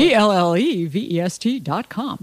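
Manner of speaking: announcer read, crisp and deliberate; radio announcer voice. Pretend. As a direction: spell it out like a radio ad. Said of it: "sound like a radio announcer".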